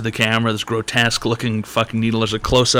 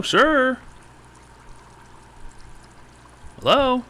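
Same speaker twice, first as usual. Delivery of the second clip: much higher, sing-song hello repeated; polite host calling; 12 semitones higher than usual. Pretend. Host calling to a caller who never answers, sing-song hello. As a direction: keep chirping hello into a dead line.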